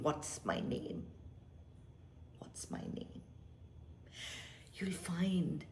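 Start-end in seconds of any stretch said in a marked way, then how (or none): 0.0-3.2 whisper. Shown as a demonstration. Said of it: "inner questioning fades to whisper".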